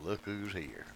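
a low, quiet, stern rebuke; stern mother. Retold or imitated. Mary finding her lost son in the temple, quietly stern. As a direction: scold the boy softly but firmly. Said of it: "No doubt she said it rather sternly".

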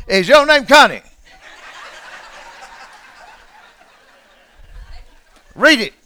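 playful teasing. Real, not acted. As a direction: tease her into reading. Teasing congregant to read.

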